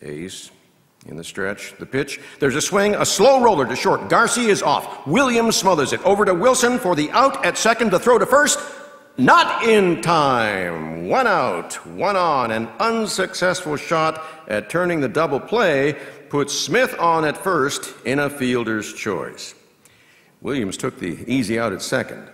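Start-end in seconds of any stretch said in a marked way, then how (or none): 2.4-8.6 high, loud, building to the throw; excited play-by-play. Real, not acted. Announcer following a ground ball to first, urgent and excited. 9.2-19.5 loud, stretched out, easing gradually; emphatic result call. Real, not acted. Announcer giving the result at first, loud then settling.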